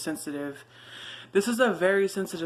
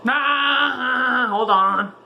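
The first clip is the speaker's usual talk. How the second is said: calling out; 6 semitones higher, 8 dB louder, much slower than usual. Real, not acted. Calling out loudly off-mic.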